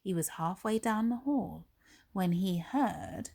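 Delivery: usual speaking voice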